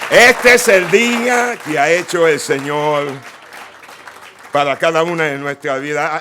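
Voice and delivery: booming Spanish, loud and high; proclaimed in Spanish. Real, not acted. Preacher booms a Spanish verse to the congregation, loudly. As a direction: ring it out to the whole room. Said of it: "throw a little bit of that Spanish in there".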